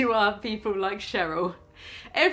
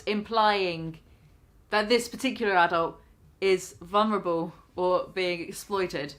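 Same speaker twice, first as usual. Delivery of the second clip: ordinary talk, nothing marked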